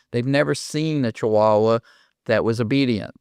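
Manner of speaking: ordinary delivery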